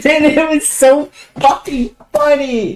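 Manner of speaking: high, slow, dissolving into laughter; can't stop laughing; real laughter swallowing the words. Real, not acted. Overcome with laughter.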